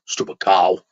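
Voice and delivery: low, muttered stupid cow; muttered insult. Retold or imitated. Captain muttering about the woman, low grumble. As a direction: grumble it under your breath. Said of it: "first time I heard it".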